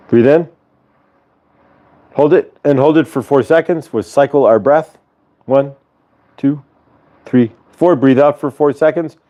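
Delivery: calm counted breathing cues; guided breathing. Acted. Leading viewers through a breathing exercise calmly.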